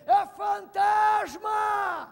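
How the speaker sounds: slow, high terrified yell; frightened shout. Acted. Frightened disciples seeing a ghost, terrified shout. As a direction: cry out in terror at a ghost. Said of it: "ficaram com medo e começaram a dizer".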